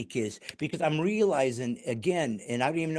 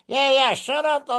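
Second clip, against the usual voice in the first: high, louder cheeky puppet retort; 9 semitones higher, 4 dB louder than usual. Retold or imitated. Host imitating a dummy talking back, high and loud. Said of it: "look over here at the audience going".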